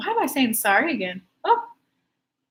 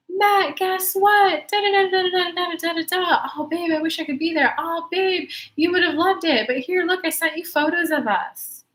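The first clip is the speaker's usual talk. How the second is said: high, excited sing-song chatter; excited wife; 7 semitones higher than usual. Acted. Wife gushing to Matt about photos of the kids' fun, excited. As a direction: gush to your husband, pitched up high.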